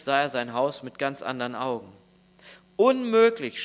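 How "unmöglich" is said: high, louder half-shout of disbelief; retold cry of dismay. The man sees his filthy house and cries out in mock dismay. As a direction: cry out in shocked disbelief, storyteller style. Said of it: "schrie er".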